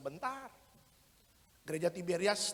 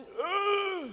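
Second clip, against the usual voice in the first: high, whiny impatient groan; husband groaning at his wife's long prayer; 12 semitones higher, 6 dB louder than usual. Retold or imitated. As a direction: moan at the endless prayer.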